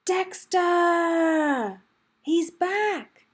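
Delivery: high, loud happy shout; delighted reunion shout; joyful call. Acted. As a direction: cheer as Jack comes back. Jack returns and calls.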